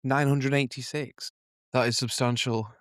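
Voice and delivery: ordinary talk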